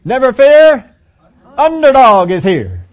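loud, high heroic cartoon cry; Underdog catchphrase. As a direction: belt it out like a cartoon superhero. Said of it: "what he would always say".

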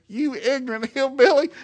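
exasperated, high-pitched insult; mad. Retold or imitated. Coach fed up with the farmer, mad. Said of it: "So my coach is so mad".

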